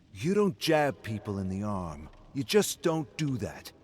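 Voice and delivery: put-on a drunk man snapping at his friend; angry. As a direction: tell him off for jabbing you. Drunk friend snapping in pub argument.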